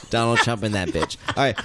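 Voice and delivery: normal talking voice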